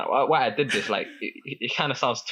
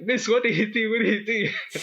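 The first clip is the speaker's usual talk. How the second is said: higher-pitched mock bewilderment; confused classmates; 9 semitones higher than usual. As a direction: imitate a baffled class asking the teacher. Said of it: "everyone is so confused".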